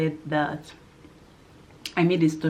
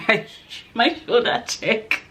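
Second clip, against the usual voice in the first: ordinary talk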